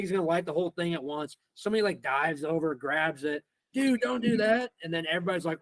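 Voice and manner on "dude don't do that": retold alarm. Retold panic as someone grabs it.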